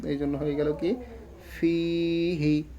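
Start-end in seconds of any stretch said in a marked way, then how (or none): 1.5-2.6 ফিহি with a long first i, short হি; long then quick. Shown as a demonstration. Teacher summing up the rule, drawn-out ফি and quick হি. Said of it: "এটাকে তাড়াতাড়ি আমরা উচ্চারণ করলাম".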